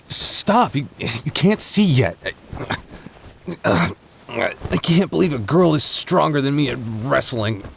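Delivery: flustered yelp. Real, not acted. Pinned while wrestling, flustered protest.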